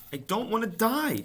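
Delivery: very high, loud desperate scream. A narrator's reading. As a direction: scream it throwing yourself down. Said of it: "screamed Wilbur".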